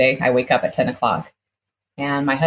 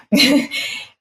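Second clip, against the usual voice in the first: rueful laugh; 10 semitones higher than usual. Real, not acted. Mom's plight, rueful laugh.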